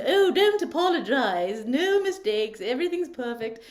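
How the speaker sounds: high, loud fluting voice; Julia Child voice. Retold or imitated. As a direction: sing it out like a cheerful TV cook. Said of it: "she always talked like this".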